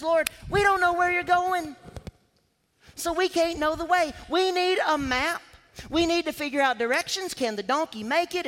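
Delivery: a lightly voiced anxious disciple; worried Thomas.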